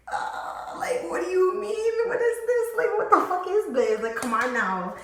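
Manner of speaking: high loud panicked voice. Retold or imitated. Startled by banging on the door, a high frantic voice. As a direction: squeal it in alarm.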